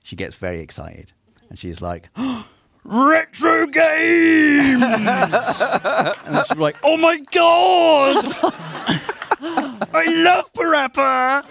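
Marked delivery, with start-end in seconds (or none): 2.1-2.8 sharp high gasp of delight; imitating Rosie's gasp; excited gasp. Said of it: "that's my Rosie impression". 2.8-11.4 much higher, louder, slow squealing fangirl; hammed-up overexcited friend; Rosie impression. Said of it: "that's my Rosie impression".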